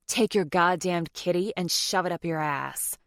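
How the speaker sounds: usual speaking voice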